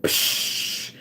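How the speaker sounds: breathy whispered psh; dismissive whisper. Retold or imitated. Her body waves off the chores with a whispered psh. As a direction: brush it off in a whisper. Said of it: "My body was like".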